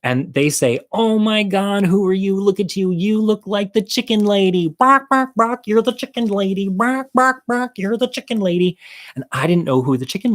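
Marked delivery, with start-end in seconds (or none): 0.9-8.8 jeering high-pitched chant of "bark, bark"; mocking queens. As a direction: camp it up high and taunting.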